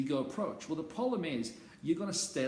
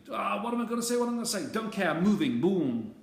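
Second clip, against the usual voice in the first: louder and higher, psyching himself up; pumped-up self-talk; 4 semitones higher, 7 dB louder than usual. Retold or imitated. Coach acting out a student's last-second nerves, pumped up. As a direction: shove the doubt aside and charge in.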